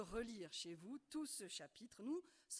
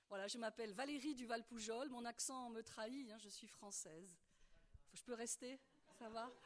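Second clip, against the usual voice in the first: usual speaking voice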